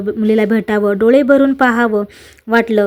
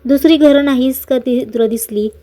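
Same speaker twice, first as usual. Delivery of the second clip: usual speaking voice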